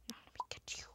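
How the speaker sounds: menacing little whisper; sneaky whisper. Pretend. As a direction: hunt them quietly. Stalking prey in game.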